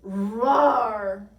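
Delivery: huge, drawn-out beast roar; monster roar. A narrator's reading. Bigfootosaurus racing after Tiny's gang, loud roar. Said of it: "he roared".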